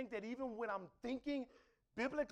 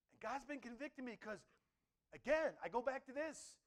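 ordinary talk

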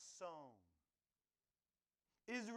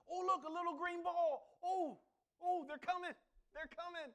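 much higher thrilled voice; excited gardener; 13 semitones higher, 9 dB louder than usual. Retold or imitated. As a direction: squeal it with garden delight. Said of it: "And finally".

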